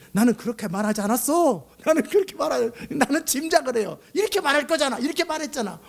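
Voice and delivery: high-pitched, heated spousal protest; imitating wife protesting. Preacher mimicking his wife in a quarrel, high indignant protest. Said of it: "우리 집사람이".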